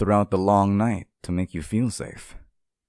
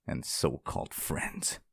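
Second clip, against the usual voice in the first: whispering; 5 semitones lower, 9 dB quieter than usual. Acted. Whispered bitter aside.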